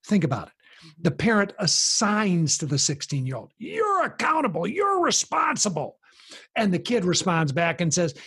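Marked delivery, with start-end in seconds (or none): 3.6-6.0 parent's shout. Retold or imitated. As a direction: point the finger and yell it. Parent blaming sixteen-year-old.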